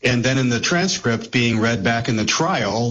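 normal talking voice

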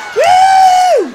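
loud, very high "woo"; long squealed whoop; hammed-up girls' night cheer; party-girl whoop.

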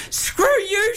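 high hammed-up scream; retold scream. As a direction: squeal it back like an outraged wife. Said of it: "she screamed back at me".

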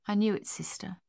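hushed, whispered admission; grieving whisper. A narrator's reading. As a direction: whisper it, quietly accepting the loss. Grieving soldier accepts brother's death.

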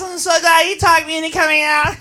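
usual speaking voice

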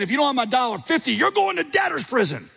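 high, mock furious threat; hammed-up throttling rage; threatening. Forgiven man grabbing buddy's throat, high mock fury. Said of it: "hauling him off and saying".

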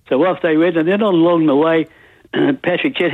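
usual speaking voice

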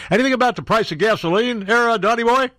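mock heckler. Host voices heckling reporter.

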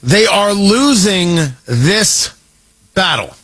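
slow and drawn out, every word weighed; deliberate slow restatement for the back rows; slow, emphatic repeat. Shown as a demonstration. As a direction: spell it out for the cheap seats. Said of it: "for the people in the cheap seats".

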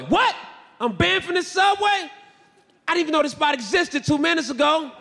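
high, shouted outrage; shocked to be banned; outraged disbelief. Retold or imitated. Shocked reply to ban.

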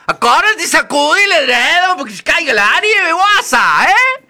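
put-on high, loud old fairy voice; a bossy magical old lady giving orders; Madre Nieve. Madre Nieve instructing the girl, high and loud.

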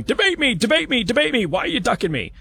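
high, whiny mock plea; mock a desperate candidate begging for a debate; whiny mock challenger. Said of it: "flailing your arms around and going".

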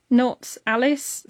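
ordinary talk, nothing marked

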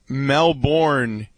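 Melbourne drawn out as MEL-BORN; American pronunciation. Shown as a demonstration. Co-host contrasting it with the Australian way, Melbourne as "Mel-born". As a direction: slowly sound out Melbourne with a full "born". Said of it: "we would say Melbourne in the United States".

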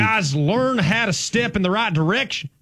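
O-line coach impression. Host scolds imaginary linemen, hollered Southern. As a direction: chew out the linemen like their coach. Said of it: "impersonate offensive line coaches".

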